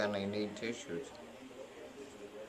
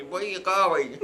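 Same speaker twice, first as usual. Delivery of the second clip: nosy resident; 7 semitones higher, 11 dB louder, slower than usual. Retold or imitated. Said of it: "one of the ballers at me".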